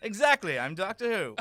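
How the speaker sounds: laughing cockney Doctor. Acted. The Doctor insisting to the prince, laughing in cockney.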